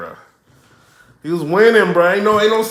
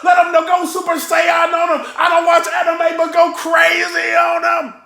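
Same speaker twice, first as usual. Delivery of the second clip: yelling at the TV; 6 semitones higher than usual. Real, not acted. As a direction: hype the rapper up from the couch. Yelling at battle on TV.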